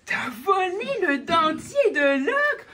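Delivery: slow, loud, laughing disbelief; laughing surprise. Acted. René laughing in disbelief at the thief. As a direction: gasp it out through laughter. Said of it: "Que dit René?".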